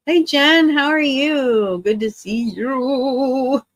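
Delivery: high, slow, stretched hello to Jen; bright greeting. Real, not acted. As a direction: sing out the hello to a friend. Greeting a viewer warmly.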